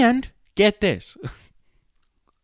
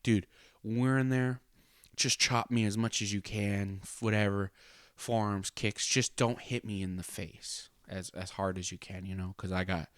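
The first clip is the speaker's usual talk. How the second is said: hushed plea; 9 dB quieter than usual. Retold or imitated. Quietly asking wrestlers for mercy.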